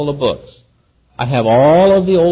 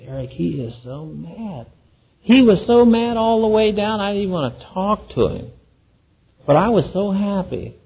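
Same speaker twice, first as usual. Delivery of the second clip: mild scared hush; 6 semitones higher than usual. Retold or imitated. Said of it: "she was so scared".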